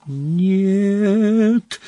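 long, high, drawn-out cry; horrified cry. A narrator's reading. Harry as Dumbledore collapses, horrified cry. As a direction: stretch the word into a high wail without extra volume. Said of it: "закричал Гарри".

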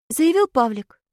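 usual speaking voice

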